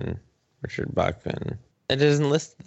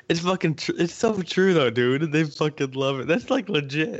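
high-pitched, tickled agreement; real amusement; 7 semitones higher than usual. Real, not acted. Friend agreeing the cheese story is true, cracking up. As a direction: insist on it through laughter.

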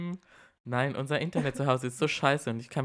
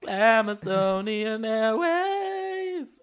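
higher, slower, more hammed-up call; drag queen airline announcement; 8 semitones higher, 4 dB louder, much slower than usual. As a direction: stretch out Glamazonian Airways grandly. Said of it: "in der sechsten oder siebten Staffel von RuPaul's Drag Race".

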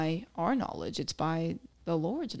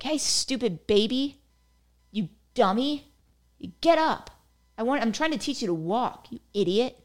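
mock scolding parent; 5 semitones higher, 4 dB louder than usual. Imagined parent scolding a baby who sat down, mock harsh. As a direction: play the mean parent yelling at the toddler. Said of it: "you won't see their parent just being like".

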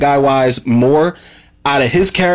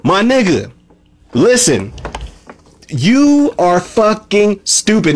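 lost it; 6 semitones higher, slower than usual. Real, not acted. Reviewer ranting at Darius for siding with the Foundation, high outraged voice. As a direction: yell at Darius like he betrayed you. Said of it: "this is when I lost my shit".

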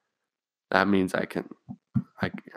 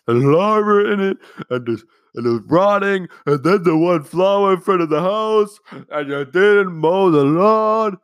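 higher, louder whiny mock complaint; mock complaining family; 10 semitones higher, 6 dB louder than usual. Family home from trip griping about the cat food, mock whiny. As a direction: whine like nagging parents.

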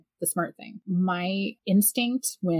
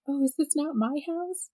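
higher, innocent questioning voice; lightly voiced bewildered stranger; confused intruder; 9 semitones higher than usual. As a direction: ask it high and puzzled, imitating her.

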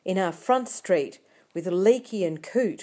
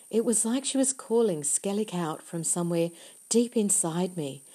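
usual speaking voice

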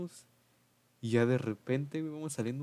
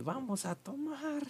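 muy aguda y lenta, en burla; voz imitada; 14 semitones higher, 4 dB quieter, slower than usual. Retold or imitated. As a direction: imita al chavo con voz chillona. (voz chillona burlona)

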